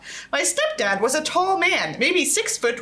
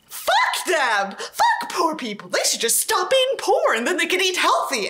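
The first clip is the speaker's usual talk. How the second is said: sarcastic rant; 6 semitones higher than usual. Acted.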